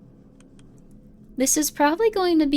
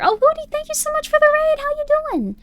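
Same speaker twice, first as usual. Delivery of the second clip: very high, gushing greeting; excited squeal; 5 dB louder than usual. Real, not acted. Streamer thanks Woody for the raid, squealing excitedly.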